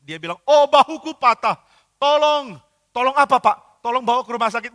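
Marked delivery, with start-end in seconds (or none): 0.5-2.6 loud, high and slow cry of pain, hammed up; an old painter who has just fallen, crying for help. Old painter calling for help after breaking his shoulder, pained loud cry. 2.9-4.7 high, loud and urgent question; alarmed helper. Acted. Helper asking the injured painter what he needs, alarmed and loud.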